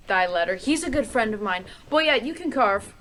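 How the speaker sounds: giddy excitement. Acted. Lady excited over letter.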